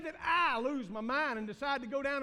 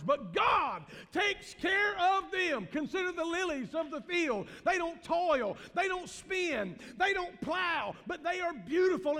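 fiery preaching; 7 semitones higher, 5 dB louder than usual. Real, not acted. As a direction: proclaim the verse like a revival preacher. Preacher quoting Jesus at full force.

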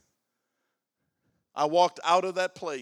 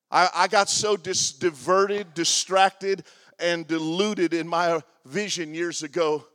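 preach; 6 dB louder than usual. Acted. Preaching testimony at full force.

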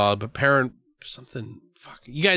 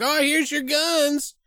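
mock clerk; slower than usual. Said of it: "they're like".

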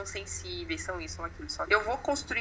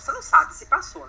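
ordinary talk

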